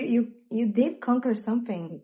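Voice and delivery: ordinary delivery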